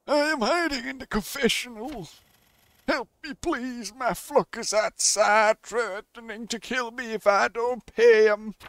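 high, slow, wailing priest; hammed-up frightened clergyman; pleading priest.

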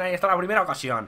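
normal talking voice

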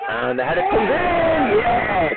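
high, drawn-out goal cry; real commentator's elated shout; excited goal call. Real, not acted. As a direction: stretch it out and soar as it goes in. Live commentary as goal goes in.

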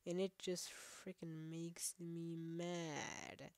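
dragging, slow and sulky; slow sulky grumble. Real, not acted. Gamer grumbles slowly about dying to the same thing. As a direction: let each word drag in a pout.

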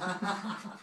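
high, soft put-on voice; small weepy squeak, hammed-up; mock tearful girl. One-night stand admitting she took mom's call, mock tearful. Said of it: "pretty much my, my crying. And she's like".

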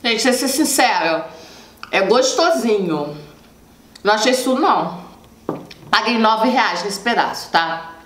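speaking between bites of dessert; talking while chewing. Real, not acted. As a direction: mouth partly full of food. Seen 1: Bites the dessert just before; jaw and cheeks look full while talking.

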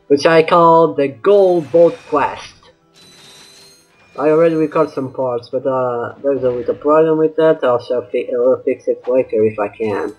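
ordinary talk

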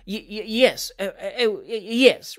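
halting, flustered yes; unconvincing, stumbling assent; nervous stammer. A narrator's reading. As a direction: stutter and stall before agreeing. Winkle nervously claims he can skate.

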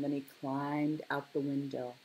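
ordinary delivery, nothing marked

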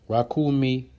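usual speaking voice

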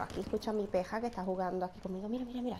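quietly drawing attention to the screen; soft hushed aside. Real, not acted. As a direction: keep it down, point it out softly. Hushed, showing teammate playing.

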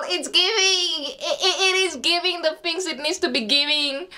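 high laughing voice; real giddy laughter; laughing delight. Real, not acted. Laughing approval of her look.

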